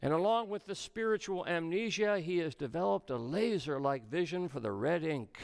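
ordinary delivery

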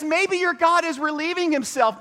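high teasing taunt; mocking. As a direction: sneer it with mock concern. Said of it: "Elijah mocked them".

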